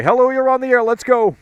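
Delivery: host picking up the next caller, bright announcer read; radio host greeting. Acted. Radio host taking a call.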